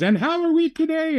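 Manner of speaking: very high singsong nurse imitation; cheery nurse. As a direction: chirp a greeting as the nurse. Imitates nurse entering at night.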